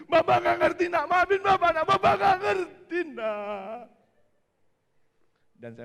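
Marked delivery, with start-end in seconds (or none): 0.1-3.9 loud, high, slow, pained cry; desperate apologizing father; anguished father's plea. Retold or imitated. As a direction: beg the son for forgiveness. Father pleading with son.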